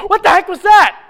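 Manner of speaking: high, very loud alarmed yell; startled shout. Acted. Actor hearing the stage boom, startled shout. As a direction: explode in shock.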